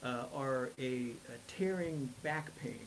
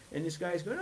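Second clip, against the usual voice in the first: usual speaking voice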